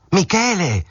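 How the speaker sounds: desperate last shout after him. A narrator's reading. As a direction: call out with everything you have. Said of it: "gridò con più forza".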